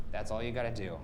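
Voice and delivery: usual speaking voice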